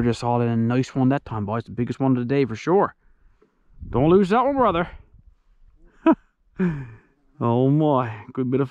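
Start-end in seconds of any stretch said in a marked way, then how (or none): 3.9-4.9 calling out. Real, not acted. Calling encouragement to his brother.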